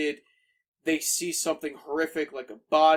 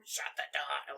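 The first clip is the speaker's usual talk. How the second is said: put-on old person voice; 10 dB quieter than usual. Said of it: "like, old person".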